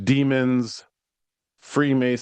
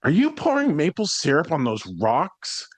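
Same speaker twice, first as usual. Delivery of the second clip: mocking cyclist; 6 semitones higher than usual. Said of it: "and she goes".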